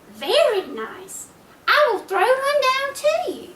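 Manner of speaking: a cheeky little pig, high and polite. Acted. Little pig answers wolf.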